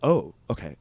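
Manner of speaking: low, soft little reply; taken-aback acceptance. Retold or imitated. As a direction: back down quietly. Retelling his meek reaction.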